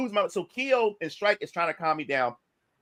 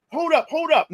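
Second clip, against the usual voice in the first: raised-pitch warning to stop; retold sharp interruption; 7 semitones higher, 3 dB louder than usual. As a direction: halt him, voice jumping up. Retold reaction as man gets up.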